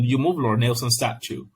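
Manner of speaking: ordinary talk, nothing marked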